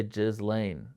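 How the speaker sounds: Ghislaine said "jizz-lane"; soft J sound and a Z in Ghislaine. Shown as a demonstration. Citing what people say, "jizz-lane" pronunciation. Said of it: "I've literally heard people say".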